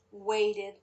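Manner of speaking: plain reading voice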